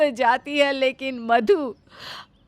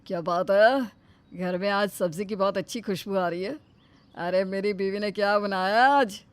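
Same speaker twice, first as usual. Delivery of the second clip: lower, cheerful son coming home; a lighter male son's voice, lower; 6 semitones lower, 5 dB quieter than usual. Acted. Son arriving home, sniffing the cooking, pleased.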